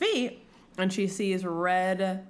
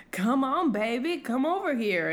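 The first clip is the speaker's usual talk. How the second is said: coaxing groom; 4 semitones higher than usual. Acted. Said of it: "And Tamlin's like".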